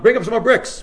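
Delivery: high, loud call to someone below; yelling down. Acted. Builder on the twentieth floor to workers below, yelling down. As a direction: shout it down from a height. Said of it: "Yell down".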